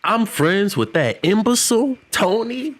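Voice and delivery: incredulous character. Retold or imitated. Character learning he's friends with Tony, mock outraged. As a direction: squeak the question in mock outrage. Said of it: "He said".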